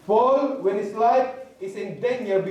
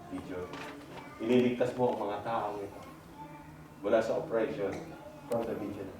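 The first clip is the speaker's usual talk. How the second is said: low, soft and reassuring; calm the frightened man, speak low; gentle voice of God; 7 semitones lower, 10 dB quieter than usual. Acted. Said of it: "Sabi ni Lord". (mahinahon at mababang tinig ng Panginoon)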